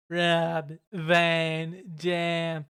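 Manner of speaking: very slow, stretched-out name announcement; Rob Van Dam. Retold or imitated. Wrestler answering Vince with his own name, drawn-out impression. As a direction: draw each word right out. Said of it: "And R V D is like".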